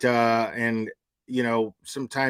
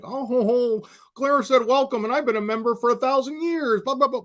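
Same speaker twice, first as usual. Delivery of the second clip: high, snooty mock voice mimicking members; mocking a smug viewer; 12 semitones higher than usual. Streamer mimics members complaining about welcomes, in a high mocking whine. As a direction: whine it back like a smug know-it-all. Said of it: "everybody's like".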